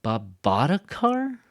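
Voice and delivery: "bought" loud, high and drawn out; exaggerated echo question stressing "bought"; surprised stress on BOUGHT. Shown as a demonstration. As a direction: slowly hammer "bought" in disbelief.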